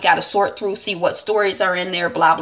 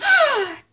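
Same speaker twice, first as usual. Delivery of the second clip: gasp. Acted. Reacting to the tablet handover.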